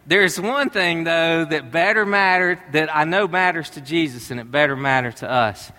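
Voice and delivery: ordinary talk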